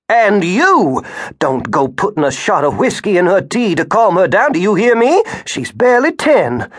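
high, loud angry scold. Acted. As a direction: tear into the husband. Grandmother rounds on husband.

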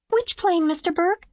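put-on high, bright child voice asking eagerly; a small girl thrilled to ask; excited little girl. A narrator's reading. Little Mimi asks Will eagerly, high and excited.

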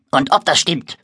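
patzig gemotzt; motzend. Acted. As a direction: trotzig zurückmotzen. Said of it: "motzt das Monster". (das Monster motzt Moritz trotzig an)

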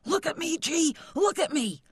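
put-on high-pitched excited kid calling out; yelling boy. A narrator's reading. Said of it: "He could hear Charlie yelling at him".

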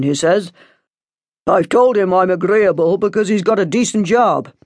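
father's firm report to the priest; stern father. A narrator's reading. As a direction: announce the match as already settled. Father arranges a match.

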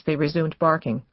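plain reading voice

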